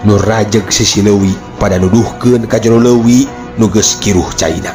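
ordinary delivery

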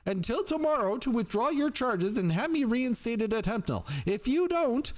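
very high-pitched, frantic threatening caller; dangerously high. A narrator's reading. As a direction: push the threat right up into a high, strained pitch. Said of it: "growing dangerously high".

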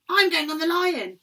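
high, loud eager cry; excited child. Acted. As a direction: shout your choice like a kid. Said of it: "cried a third one".

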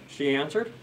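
plain reading voice

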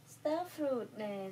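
Star fruit drawn out slowly, star stressed; slow teaching word. Shown as a demonstration. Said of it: "teaching child fruit names".

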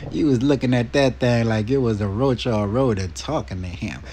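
normal talking voice

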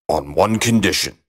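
low-pitched bargaining frog; name terms as a low frog; lightly put-on low frog voice; frog character. A narrator's reading.

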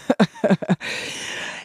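quiet airy laugh under her breath; soft breathy laugh. Real, not acted. Host laughing quietly at the cashier's dry reply. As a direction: let out a soft breathy chuckle.

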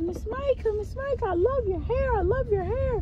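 high sing-song mimicry of pupils; kids hyping. Pupils complimenting their teacher's hair, gushing kid voices. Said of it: "the kids was hyping me up".

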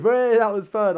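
loud, high cheer; mock cheer. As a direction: call it out with put-on glee. Mock cheer as the game ends.

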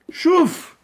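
high, loud one-word command; imperative to a lad. Acted. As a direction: call it out sharply to a boy. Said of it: "Quando eu viro pra um rapaz".

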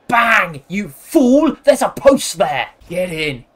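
loud, punchy crowing; gloating shout. Real, not acted. Streamer mocking a player who hit post, gloating shout. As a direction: yell it in triumph at the loser.

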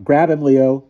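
put-on high, loud boy's command; sharp order. A narrator's reading. Ludwig orders Leo to grab Tycho, loud command. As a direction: order your henchman to act. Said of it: "Ludwig ordered".

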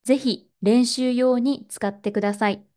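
ordinary talk, nothing marked

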